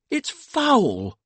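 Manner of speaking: high indignant outburst, falling at the end; indignant wife. A narrator's reading. Sadie to her husband about the news, indignant cry. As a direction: cry out in high-voiced disgust. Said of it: "said Sadie".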